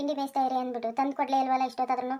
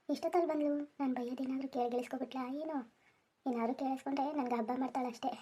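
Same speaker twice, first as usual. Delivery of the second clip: much softer, said under his breath; muttered aside; 9 dB quieter than usual. Acted. Husband muttering to himself about his wife, hushed aside. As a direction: grumble so she won't hear.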